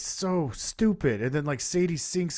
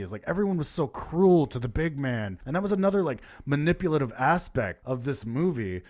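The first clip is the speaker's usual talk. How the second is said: ordinary talk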